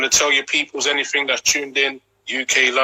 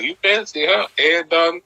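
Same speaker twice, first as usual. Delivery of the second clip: quoting the song; 7 semitones higher than usual. Retold or imitated.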